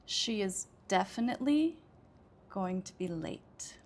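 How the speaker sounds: teacher's clear demo with "definitely" and "late" punched; stress on DEFINITELY and LATE. Shown as a demonstration. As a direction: lean hard on "definitely" and "late". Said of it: "I would say two words, uh, louder".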